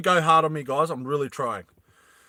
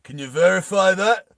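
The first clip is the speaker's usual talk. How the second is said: louder, higher demand; demanding question; 4 semitones higher, 5 dB louder than usual. Acted. Hammy demanding Roy verify the location, louder. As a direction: press him for proof. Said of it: "Hammy looks over to Roy and he goes".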